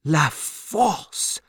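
stunned echo with a rise; shocked repetition. A narrator's reading. Banker echoes the prison's name, shocked. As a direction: repeat it in disbelief. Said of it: "repeated Mister Lorry, shocked".

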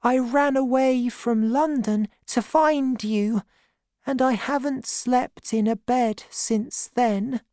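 put-on high, small boy's voice; boy begging his aunt; young David pleading. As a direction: plead like a tired runaway child. David's quoted plea, high.

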